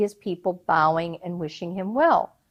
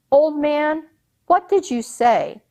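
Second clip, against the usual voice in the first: raised, higher challenging call; soldier's challenge; 7 semitones higher, 3 dB louder than usual. Acted. Soldiers confronting an old man, challenging him. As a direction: demand an answer from the old man.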